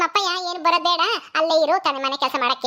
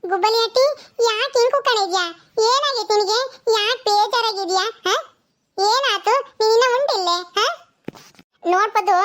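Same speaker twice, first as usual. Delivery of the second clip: put-on high, eager little girl asking questions; child voice; 6 semitones higher, slower than usual. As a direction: play a small curious child, pitched up.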